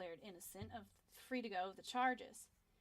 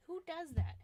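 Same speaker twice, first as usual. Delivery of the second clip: indignant; 8 semitones higher, 9 dB louder than usual. Real, not acted. Ranting about pencils lacking green.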